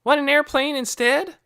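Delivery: high, loud voiced boy offering a game; playful big brother. A narrator's reading. Big boy playing with little brother.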